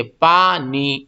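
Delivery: پانی with the long aa drawn out; stretched vowel. Shown as a demonstration. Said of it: "پانی میں کتنا کھینچتے ہیں؟".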